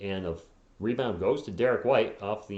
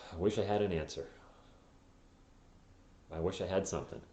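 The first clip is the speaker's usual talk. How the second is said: dejected; 4 semitones lower, 8 dB quieter, faster than usual. Real, not acted. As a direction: sigh it out, no answer left. Answering a fan, dejected.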